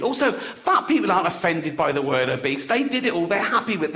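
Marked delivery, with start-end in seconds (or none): none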